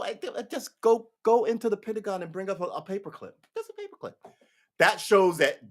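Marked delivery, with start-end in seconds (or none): 0.0-4.1 high coaxing that softens at the end; mock casual persuasion; wheedling handler. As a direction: make a tiny favour sound harmless, pitched high. Plays handler asking small favour.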